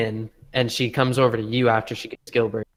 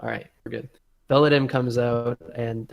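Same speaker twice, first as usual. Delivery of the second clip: ordinary talk, nothing marked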